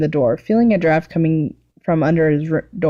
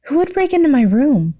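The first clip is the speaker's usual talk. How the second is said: alarmed question; 9 semitones higher than usual. Acted. Midoriya finding his room trashed, alarmed and higher.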